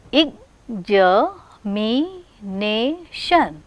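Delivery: "examination" very slowly, each syllable separate. Shown as a demonstration. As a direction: break "examination" into five drawn-out beats. Teacher counts the word's syllables.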